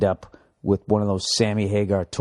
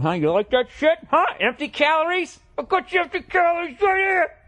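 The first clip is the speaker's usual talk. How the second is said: mock trash talk. Taunting the alcohol demon, wrestling.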